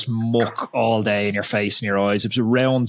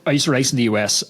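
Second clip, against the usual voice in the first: ordinary talk, nothing marked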